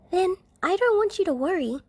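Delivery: put-on high little-girl voice, hushed; child Eri whispering. A narrator's reading. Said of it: "Eri-chan whispers".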